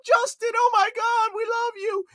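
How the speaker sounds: very high gushing fangirl squeal; hammed-up swooning fan voice; mock adoring fans. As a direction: mockingly squeal like a starstruck fan. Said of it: "they're all getting around".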